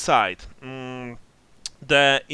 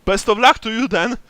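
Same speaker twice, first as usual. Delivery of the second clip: terrified; 7 semitones higher, 11 dB louder than usual. Acted. NPC nervously wishing them luck.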